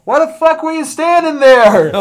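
high, loud shout through laughter; laughing yell. Retold or imitated. Brother yelling at him for standing in the way. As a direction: yell it while cracking up. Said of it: "my brother Jimmy goes".

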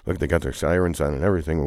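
ordinary delivery

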